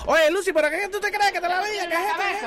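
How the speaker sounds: high, fast, exasperated Spanish rant; Ricky yelling in Spanish. Retold or imitated. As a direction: rattle it off high and fast like a fed-up husband. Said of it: "Ricky would lick off a couple of Spanish words ... and just go".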